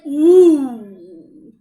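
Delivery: loud, slow, menacing fox voice; sly fox. Acted. Fox looming over the mouse, loud and slow. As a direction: stretch it out like a hungry fox.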